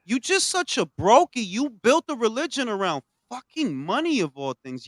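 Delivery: usual speaking voice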